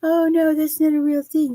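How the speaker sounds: high-pitched mock objection; mocking naysayers. Host defends sleepy pet pheromones against doubters, mockingly imitating them. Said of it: "I don't care what people say".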